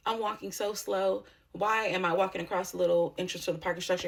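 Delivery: usual speaking voice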